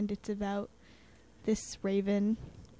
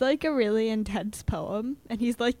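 ordinary talk, nothing marked

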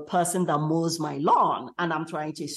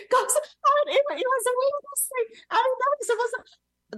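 talking through high-pitched, squealing laughter; helpless laughter; 4 dB louder than usual. Real, not acted. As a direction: crack up mid-sentence, words squeezed out high and loud. Breaks up laughing retelling the mix-up.

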